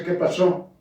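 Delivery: normal talking voice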